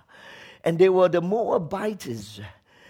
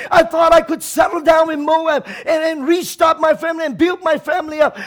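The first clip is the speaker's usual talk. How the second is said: a bitter cry, growing louder and higher; 6 semitones higher, 3 dB louder than usual. Acted. Naomi crying out to the people of Bethlehem, bitter and loud. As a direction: pour out Naomi's bitterness to the town. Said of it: "she cried out to the people".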